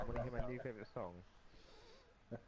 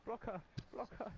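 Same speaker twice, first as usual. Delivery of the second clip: urgent callout; 8 semitones higher than usual. Real, not acted. Urgent game callouts, high.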